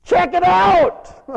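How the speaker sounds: slow, high, drawn-out yell; exuberant shout. Acted. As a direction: holler it long and loud. Shouted aside after the taunt.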